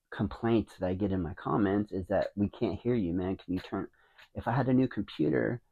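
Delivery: ordinary delivery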